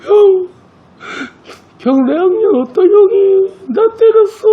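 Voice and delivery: put-on very high, sobbing little boy's voice; a younger boy weeping as he tells his big brother who hit him; crying child. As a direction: blubber it out like a bullied kid. Kid crying to older brother.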